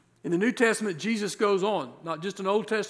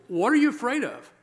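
"what are you afraid of" pushed higher and louder, urging; pressing question; 7 semitones higher, 4 dB louder than usual. Shown as a demonstration. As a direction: press the congregation for an answer, stress on "are". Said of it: "I want you to process".

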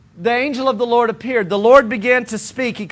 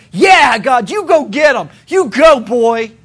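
loud excited cheer, pitched up; cheering Moses; 6 dB louder than usual. Acted. Moses cheering God on, shouting excitedly. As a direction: egg God on like a hype man. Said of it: "Moses is eating this up going".

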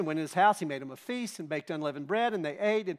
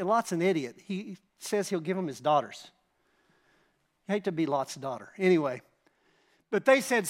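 ordinary talk

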